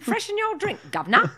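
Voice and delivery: high, giggly mock-Cockney British; bad British accent. Offering a drink in jest, high comic British accent. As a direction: squeal it in a silly British accent. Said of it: "try to talk in British accents".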